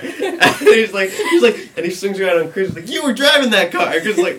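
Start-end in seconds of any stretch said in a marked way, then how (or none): 2.9-3.9 loud, high-pitched mock cop yell; accusing shout. Cop rounding on a kid, shouting an accusation. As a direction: hurl the accusation, imitating a cop.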